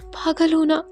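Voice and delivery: fearful confession. Acted. Anshika explains her fear, higher voice.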